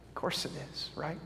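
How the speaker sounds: soft confident whisper; hushed. Real, not acted. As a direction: drop to a breath for emphasis. Soft answer to own question.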